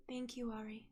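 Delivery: drowsy and very soft; sleepy voice. A narrator's reading. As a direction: murmur it half asleep. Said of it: "you hear a sleepy voice say".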